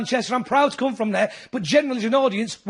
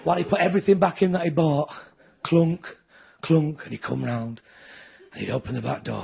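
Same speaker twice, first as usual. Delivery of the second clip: ordinary talk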